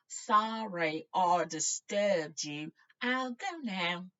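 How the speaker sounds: loud, slow half shout. Acted. Harry calling to the deaf-seeming wizard, loud. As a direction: raise the voice for a deaf man. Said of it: "he half shouted".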